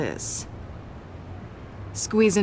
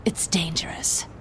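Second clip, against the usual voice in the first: menacing whisper; 3 dB quieter than usual. Acted. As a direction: breathe the warning into his ear. Villain's threat to the hero.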